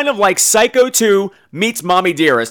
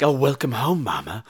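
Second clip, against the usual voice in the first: soft, low, strained welcome; understated uneasy daughter; tense daughter; 5 semitones lower, 5 dB quieter than usual. Acted. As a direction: greet mama quietly with false sweetness. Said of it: "this weird tension".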